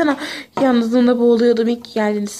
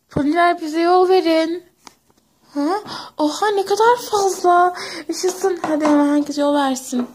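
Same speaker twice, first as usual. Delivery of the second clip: excited cheer; 6 semitones higher than usual. Acted. Cheering crowd making way.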